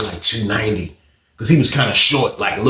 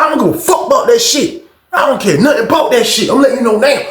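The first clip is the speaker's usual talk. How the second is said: higher, louder, defiant shouting; loud threat; 9 semitones higher, 4 dB louder than usual. Retold or imitated. Tim shouting a defiant warning at Johnson. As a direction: warn him loudly, no backing down. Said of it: "Tim got loud with him".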